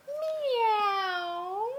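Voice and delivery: high, drawn-out meow; cat meow. Pretend. Grandma meowing high for the baby. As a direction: meow it high and long like a kitten.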